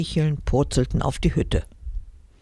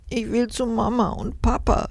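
mild sob; 7 semitones higher than usual. Acted. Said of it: "schluchzte sie".

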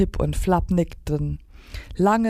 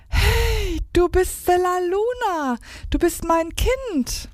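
very high, thin voiced exclamation; high, drawn-out voice of wonder; amazed stage whisper. A narrator's reading. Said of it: "flüsterte".

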